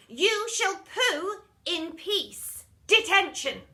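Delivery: loud, slow, bellowing strict teacher; furious teacher. Acted. Miss Seeth yelling at pupil in toilet, furious. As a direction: roar it like a tyrant teacher.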